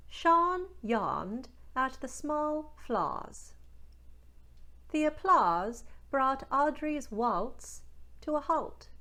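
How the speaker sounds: slow Newfoundland sentence, aw opened to ah; Newfoundland accent, every aw to ah. Shown as a demonstration. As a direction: read it slowly in a Newfoundland accent. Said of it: "Let's try some more".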